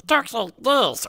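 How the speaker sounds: high, slow distorted gadget voice; mock voice-changed speech; voice-changer demo. Shown as a demonstration. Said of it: "Can a voice changer, so every time I talk".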